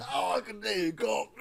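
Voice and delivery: a failed Cockney that lands in Australia; hammed-up botched Cockney accent; bad Cockney that sounds Australian. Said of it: "That's Australian".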